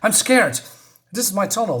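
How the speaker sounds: put-on mildly panicked girl, pitched high; scared, shaking. Girl tells the boy about her tunnel, acted fright. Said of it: "she's sweating more and more ... Why is she shaking".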